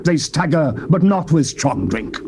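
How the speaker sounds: ordinary talk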